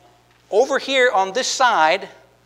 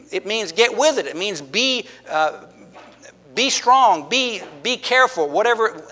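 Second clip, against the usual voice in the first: usual speaking voice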